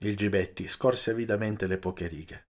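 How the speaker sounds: ordinary delivery, nothing marked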